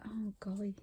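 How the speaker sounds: awed whisper; soft unvoiced gasp of wonder. Real, not acted. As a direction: breathe it out, no voice. Awed at gift fabric.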